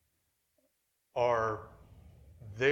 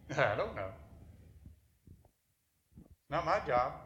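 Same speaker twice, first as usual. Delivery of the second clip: mildly sarcastic brush-off; lightly acted sarcastic retort. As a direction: shrug it off with a touch of sarcasm. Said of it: "That's sarcasm".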